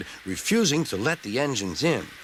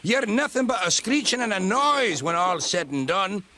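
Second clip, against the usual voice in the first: angry outburst; 11 semitones higher than usual. Acted. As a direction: let fly at the brake van. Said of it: "Donald burst out".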